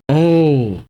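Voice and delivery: plain reading voice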